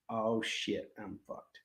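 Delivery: low, hushed mock dread; quiet, comic "I'm doomed" delivery; mock dismayed realization. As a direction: let it sink to a near whisper. Voices player realizing he will lose.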